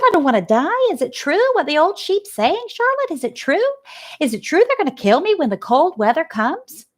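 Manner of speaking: high, pleading little pig; frightened piglet. Acted. Scared piglet Wilbur pleads with Charlotte, high and anxious. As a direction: beg Charlotte for the truth, anxious.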